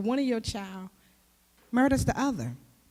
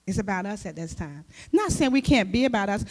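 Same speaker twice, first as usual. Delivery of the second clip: ordinary delivery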